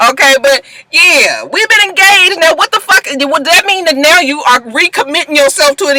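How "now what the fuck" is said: ordinary talk, nothing marked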